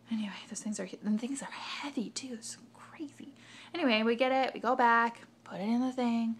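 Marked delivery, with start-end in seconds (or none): 0.1-3.3 soft unvoiced aside, almost breath; whispered aside. Real, not acted. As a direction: drop to a whisper for the side comment.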